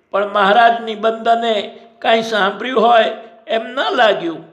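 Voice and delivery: plain reading voice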